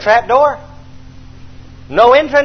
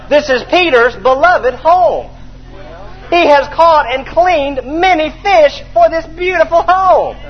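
fond admiration; 6 semitones higher, slower than usual. Acted. Admiring Peter's home.

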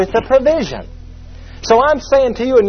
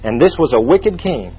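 ordinary talk, nothing marked

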